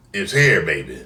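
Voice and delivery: usual speaking voice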